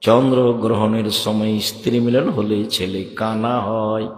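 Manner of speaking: slow, low monotone recital with drawn-out endings; reading out the book. Retold or imitated. Preacher reads out the book's false rules, slow low recital. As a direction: read each rule slowly and low, stretching the last word. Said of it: "তখন উনি লিখছেন".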